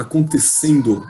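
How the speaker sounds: acontecendo said slowly, every syllable stretched; slow down and lean on acontecendo; drawn-out emphasis. Shown as a demonstration. Teacher stressing the key word to students, slow emphasis. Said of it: "Presta atenção nessa palavra".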